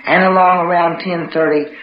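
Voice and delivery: ordinary delivery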